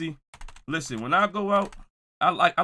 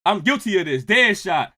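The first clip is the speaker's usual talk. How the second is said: ordinary talk, nothing marked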